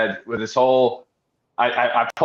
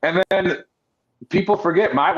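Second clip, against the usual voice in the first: ordinary talk, nothing marked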